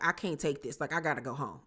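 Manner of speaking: retold crying. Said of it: "And she's crying".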